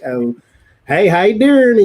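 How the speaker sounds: loud, high hammed-up country greeting; mock country accent. Streamer mimicking how she avoided talking at work, exaggerated country accent. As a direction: holler hello in a put-on country twang. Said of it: "I don't actually talk that way".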